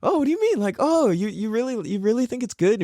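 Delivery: high-pitched fake-humble surprise; coy, disbelieving humility; feigned modesty. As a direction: act like you had no idea. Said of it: "act like you didn't know how".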